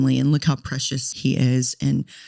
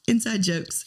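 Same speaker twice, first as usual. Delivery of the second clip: laughing; 8 semitones higher, 3 dB quieter than usual. Real, not acted. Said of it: "We're laughing at each other".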